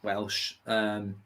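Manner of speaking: ordinary delivery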